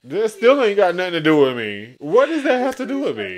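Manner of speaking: amused defensive shout; laughing outburst. Real, not acted. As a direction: scoff it out loudly.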